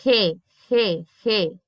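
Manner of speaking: "Re, re, re" as separate "hê" sounds; Spanish G name, "re" said three times apart; G as aspirated R. Shown as a demonstration. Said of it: "como se fosse um R".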